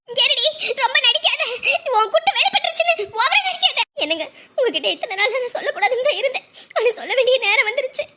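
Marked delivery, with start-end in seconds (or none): none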